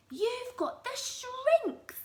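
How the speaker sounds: high, slow gloating taunt; Mister Twit crying out. Acted. Husband pointing at his wife, high drawn-out taunt. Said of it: "cried Mister Twit".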